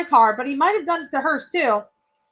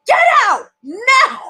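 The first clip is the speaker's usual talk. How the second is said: high, loud, drawn-out shout; retold yell; 9 semitones higher, 5 dB louder, slower than usual. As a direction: imitate the boss finally yelling, each word stretched. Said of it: "Barb yelled at somebody".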